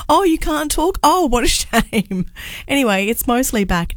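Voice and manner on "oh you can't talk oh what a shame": higher, drawn-out mock commiseration; mock sympathy. Family teasing her about losing her voice, mock sympathy. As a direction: feign pity, dragging out the last words. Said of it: "my family were very happy".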